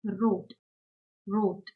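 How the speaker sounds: "wrote" said as German rot, long o; German example word. Shown as a demonstration. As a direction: say "wrote" like roht, crisp final t. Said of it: "For example".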